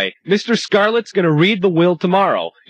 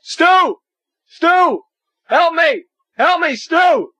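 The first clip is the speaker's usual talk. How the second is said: panicked cry for help; 12 semitones higher, slower than usual. Acted. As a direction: scream for rescue. Stuck to giant dial.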